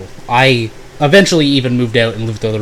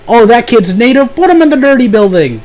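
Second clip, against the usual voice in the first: much higher, louder mock official voice, sneering; mocking school official; 10 semitones higher, 5 dB louder than usual. Mocking how the school assigned his dorm, in a high sneering put-on voice. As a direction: ham up a clueless housing clerk.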